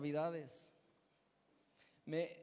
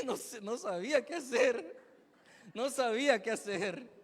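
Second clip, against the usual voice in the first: laughing; 4 semitones higher, 4 dB louder than usual. Real, not acted. Laughing at his bewilderment.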